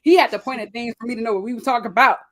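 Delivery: ordinary talk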